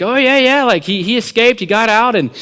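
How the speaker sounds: high, loud playing-along voice; eager confirmation. Friend keeping the prank going, high and eager. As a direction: confirm the tall tale eagerly.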